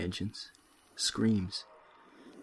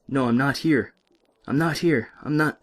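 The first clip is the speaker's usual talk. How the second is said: loud, high, desperate denial; frantic shouting captive; panicked shout; 5 semitones higher, 7 dB louder than usual. Acted. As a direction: refuse reality at the top of your voice. Panicked denial before injection.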